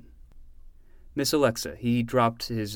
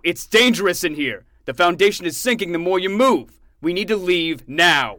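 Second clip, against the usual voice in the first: stern scolding; 6 semitones higher, 7 dB louder than usual. Acted. Uncle orders his nephew to stop, stern and loud. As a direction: lay down the law.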